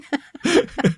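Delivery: high, amused burst of laughter; short high giggle; real laugh. Real, not acted. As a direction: laugh in recognition. Laughs at cover story question.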